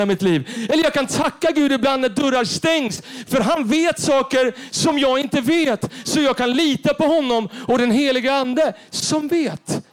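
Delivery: high, loud, driving preacher voice; preaching at full force. Acted. Preacher urging the congregation to trust God, fervent. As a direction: lift the church with fervent preaching.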